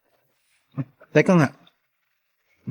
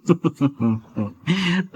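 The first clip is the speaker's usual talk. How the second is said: quiet sneaky chuckle; a pleased little private laugh; sly chuckle; 2 semitones lower, 7 dB quieter than usual. Acted. Sneaky drinker alone, pleased. (palihim na tawa)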